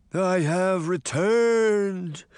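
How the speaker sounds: slow, high ghostly croak; croaking phantom. A narrator's reading. Sabrina posing as the Headless Horseman, croaking slowly. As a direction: draw each word out like a menacing spectre. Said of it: "Sabrina croaked".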